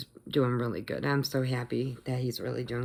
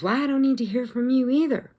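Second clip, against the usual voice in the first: high, louder mock snapping back; retold angry yelling; 9 semitones higher, 5 dB louder than usual. Rude man to post office clerk, irate retort. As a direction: imitate the rude man shutting her down. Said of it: "he's still yelling at the lady".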